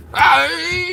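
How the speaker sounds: high, loud sudden cry; sudden yell. Real, not acted. Breaks off own chat, sudden yell. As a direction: let out a startled-sounding high yelp.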